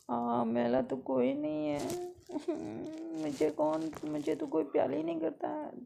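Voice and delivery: high, loud sulky complaint; drama queen whine. Acted. Sister sulks to mom that nobody loves her, high whiny voice. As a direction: whine like a jealous little sister. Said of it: "और drama queen".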